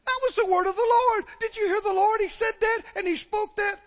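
high, loud thrilled churchgoer; excited. Acted. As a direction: exclaim it in high-pitched amazement. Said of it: "People will get excited and go".

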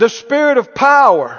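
ringing call-and-response proclamation; out-loud declaration. Shown as a demonstration. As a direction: shout it out for the congregation to repeat. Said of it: "Everybody said out loud".

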